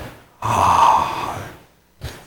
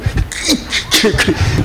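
soft breathy giggle; 7 semitones higher, 4 dB quieter than usual. Retold or imitated. Grandchild delighted on the ride.

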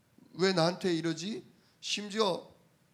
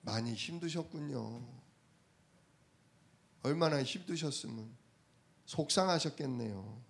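softer and lower, gently consoling; sympathetic; 4 semitones lower, 4 dB quieter than usual. Retold or imitated. Preacher gently consoles the shopkeeper, soft and low. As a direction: soothe a tired shopkeeper. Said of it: "마음을 읽었어요".

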